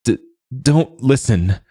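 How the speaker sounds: frightened woman pleading between quick breaths; breathless fear. A narrator's reading. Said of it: "Mila said, breathing fast".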